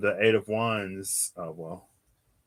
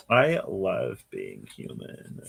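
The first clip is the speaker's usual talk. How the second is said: slow, much lower, feigned contentment; mock sincerity; 9 semitones lower, slower than usual. Streamer backtracking after griping about being human, mock sincerity. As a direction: say it slowly and low, pretending to love it.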